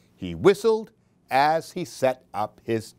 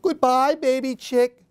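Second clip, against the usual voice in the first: Mother Hen; 10 semitones higher than usual. Acted. Mother Hen saying goodbye to Baby Chick. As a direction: wave off the little one like a fond mother hen. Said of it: "said Mother Hen".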